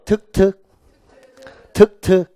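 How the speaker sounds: teacher's call-and-repeat chant; demonstrated short reading. Shown as a demonstration. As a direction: model it crisply for the class to repeat. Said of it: "이렇게 읽게요".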